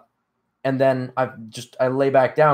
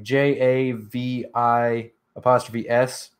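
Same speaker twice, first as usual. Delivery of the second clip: J A V I apostrophe S spelled letter by letter, slowly; slower than usual. Shown as a demonstration. Streamer spells a channel name for chat, letter by letter. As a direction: spell it out slow and clear for the search. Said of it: "if you type in".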